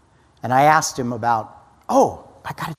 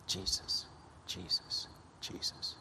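breathy whispered name, fading; whispered prayer; 5 semitones lower, 23 dB quieter than usual. Real, not acted. Whispered prayer after story.

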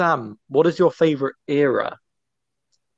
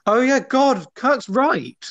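high-pitched dawning surprise; surprised realisation; 9 semitones higher, much slower than usual. Real, not acted. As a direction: pitch leaps as the news sinks in. Finds the news is true.

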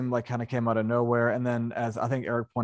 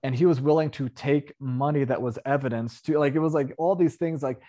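usual speaking voice

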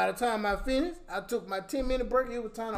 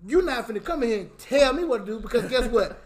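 louder, rising angry rant with laughter breaking in; Kevin Hart rant; 3 semitones higher, 7 dB louder than usual. Real, not acted. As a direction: tell her off, getting worked up. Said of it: "I'm getting a little angry right now".

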